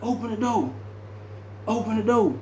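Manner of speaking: barking the order through the door; mock major's order.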